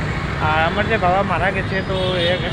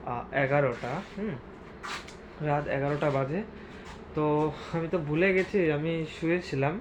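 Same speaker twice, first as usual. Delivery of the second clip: low, soft voice while others sleep; quiet late-night murmur to the camera; hushed night talk; 6 semitones lower, 12 dB quieter than usual. Real, not acted. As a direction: keep it down, everyone's asleep. Late night, household asleep.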